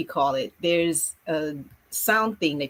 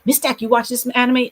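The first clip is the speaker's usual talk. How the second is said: put-on higher, louder eager kid; kid asking; 7 semitones higher, 5 dB louder than usual. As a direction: pipe up like an excited pupil. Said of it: "so I get kids".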